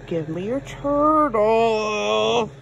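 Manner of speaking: stretched out, patient but firm; slow demand. Real, not acted. Owner asking dog for turtle, slow insistent demand. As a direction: drag it out, insisting.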